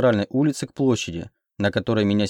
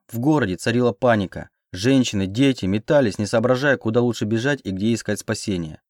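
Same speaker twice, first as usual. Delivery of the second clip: ordinary delivery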